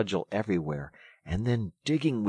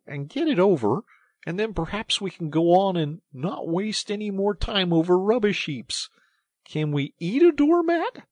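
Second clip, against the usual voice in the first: high, loud, mounting tirade; exasperated sarcasm; 12 semitones higher, 8 dB louder than usual. A narrator's reading. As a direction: scold a friend over useless rubbish.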